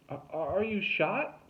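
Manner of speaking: high, slow, stammered call; mild yell, stretched out in alarm. A narrator's reading. Tuna calls up to dangling Derek, alarmed yell. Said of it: "Tuna yelled".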